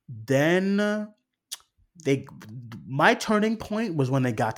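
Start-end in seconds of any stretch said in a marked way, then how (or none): none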